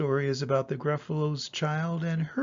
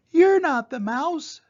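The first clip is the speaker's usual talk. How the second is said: put-on much higher, louder little monster child; 10 semitones higher, 7 dB louder than usual. Child confronts the snake, much higher. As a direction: call it out high and a touch cross.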